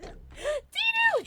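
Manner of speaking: high squealed name; gasp, then squeal the name; excited greeting. Real, not acted. Streamer spotting a friend in chat, excited squeal.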